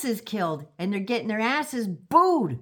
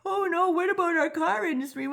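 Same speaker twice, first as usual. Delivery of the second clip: much higher mock whining plea; whining premiers; 9 semitones higher than usual. Mocking premiers begging not to tariff, much higher whine. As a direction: whimper the pleas like spoiled kids. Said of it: "premiers whining and crying".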